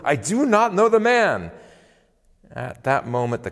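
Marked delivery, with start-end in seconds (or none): 0.0-1.5 high, loud, vehement outburst; cursing, sworn denial. A narrator's reading. Peter swearing an oath to bystanders, angry vehement denial. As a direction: insist hotly, pitch thrown right up. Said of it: "he began to curse".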